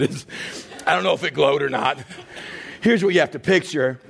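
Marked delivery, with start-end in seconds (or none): none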